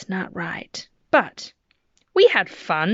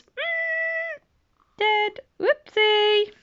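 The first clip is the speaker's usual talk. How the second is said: much higher, squeaky comic "dead", "Whoopsie"; mock-shocked punchline; much slower than usual. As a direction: play the gruesome ending for giggles.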